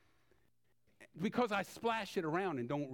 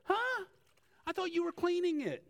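much higher, playful mock shock; mock surprise; 13 semitones higher than usual. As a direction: play shocked for the kids. Object lesson with mock surprise.

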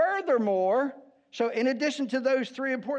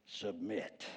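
Submit said much lower and softer, a heavy deep drop; deeper voice; 13 semitones lower, 14 dB quieter than usual. Shown as a demonstration. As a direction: let Submit sink low and heavy. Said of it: "We even say it with a deeper voice".

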